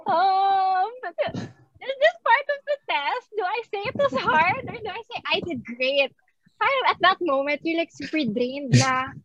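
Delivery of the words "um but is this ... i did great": high, unsure, panicked inner voice; puzzled whispering to herself, high and anxious; nervous confusion. Retold or imitated. Student at interview end, nervously unsure how to answer.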